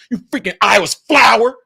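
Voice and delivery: angry put-down; mad insult. Shown as a demonstration. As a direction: snap it at them in anger. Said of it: "how mad I am when I say it".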